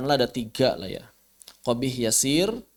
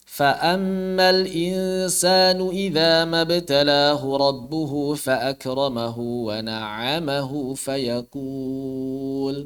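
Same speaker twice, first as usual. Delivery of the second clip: chanted tilawah, quicker flow; Quranic recitation; faster than usual. Shown as a demonstration. Teacher reciting Al-Fajr to show where to stop. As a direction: recite the verse in melodic tilawah.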